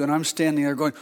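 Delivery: usual speaking voice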